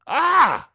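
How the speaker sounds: sharp high shriek of fright; startled scream. A narrator's reading. Skeletor hears the voice again and screams in fright. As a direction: shriek out in sudden shock. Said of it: "I scream".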